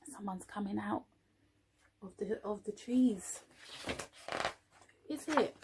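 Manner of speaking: quiet storytelling suspense; hushed suspense. A narrator's reading. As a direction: lean in, whisper-like suspense.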